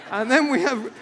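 loud, high mock announcer cracking up; announcer voice breaking into laughter. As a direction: try the call again but laugh through it. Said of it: "I don't know if he had a voice like that".

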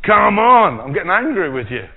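loud, stern come on; God losing patience. Pretend. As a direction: scold Moses as an angry God. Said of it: "God says".

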